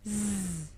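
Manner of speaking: "Z." as a soft, quiet buzzing zzz; buzzing z sound. Shown as a demonstration. Teacher shows pupils the sound they hear, soft buzz. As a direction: softly buzz "Z." to model the sound. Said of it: "lire les mots avec le son".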